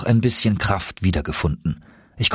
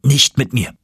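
firm, mildly heated refusal; indignant cry; 3 semitones higher, 4 dB louder than usual. A narrator's reading. Uncle rejects turning back, indignant refusal. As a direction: refuse outright. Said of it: "rief mein Onkel".

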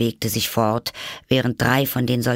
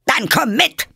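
high, loud roared command; bellowing; 6 dB louder than usual. Acted. Queen summons Alice to the game, bellowing. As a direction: roar it as an order. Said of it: "brüllte die Königin".

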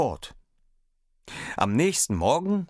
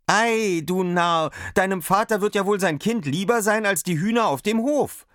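cheerful father; 11 semitones higher, 4 dB louder than usual. A narrator's reading. Father answers, laughing at son.

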